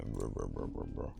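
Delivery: low, gruff mock grumble; gruff grumbling. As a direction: growl out mumbled filler like a smith. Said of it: "I'm a gruffy, sunny man".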